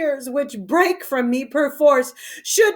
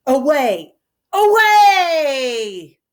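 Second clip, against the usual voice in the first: furious shout; 3 semitones higher, 5 dB louder, much slower than usual. Acted. King ordering himself out of the room, shouting. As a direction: drive her off with a bellow. Lear storming out.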